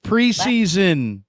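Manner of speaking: drawn-out scoffing "Preseason"; a dragged-out sneer at the word; mock dismissive. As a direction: say it slow and high like it means nothing.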